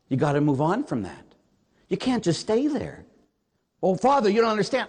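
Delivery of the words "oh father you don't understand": higher, protesting parishioner imitation; mock complaint. Parishioner objecting to Father, mock complaint. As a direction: imitate someone pleading to be understood.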